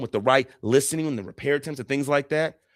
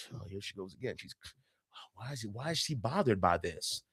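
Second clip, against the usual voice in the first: much softer, eye-rolling aside; muttered inner thought; 5 semitones lower, 13 dB quieter than usual. Retold or imitated. Listener's private thought about his partner, soft exasperated mutter. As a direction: mutter it under your breath, exasperated. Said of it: "you could even think in your mind".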